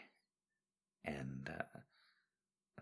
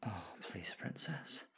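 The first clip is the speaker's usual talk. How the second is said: faint unvoiced plea; whispering; 11 dB quieter, slower than usual. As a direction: whisper it, spent.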